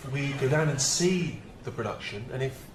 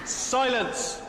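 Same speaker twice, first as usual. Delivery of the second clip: high, booming call for silence; commanding shout; 12 semitones higher than usual. Acted. Director silencing the opera house with a shout. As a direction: bellow it out to hush the room.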